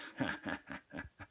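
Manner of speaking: breathy unvoiced menacing chuckle; a quiet demonic snicker; soft evil laugh. Taunting the scared woman with a hushed sinister laugh. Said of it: "I started to laugh".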